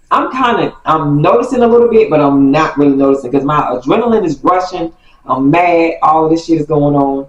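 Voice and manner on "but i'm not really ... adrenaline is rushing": usual speaking voice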